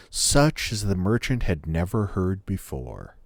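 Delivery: ordinary delivery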